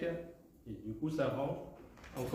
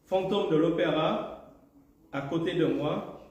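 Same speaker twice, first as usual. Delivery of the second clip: deliberate poem reading, each line set apart by a pause; haiku recitation; 2 semitones higher than usual. Acted. Poet reads prize-winning haikus to the audience, deliberate recitation. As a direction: lift the opening line, let the last one settle.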